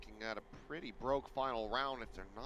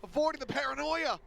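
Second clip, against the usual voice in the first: hyped play-by-play; 7 dB louder than usual. Real, not acted. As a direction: ride the hype of the play. Live play-by-play climax.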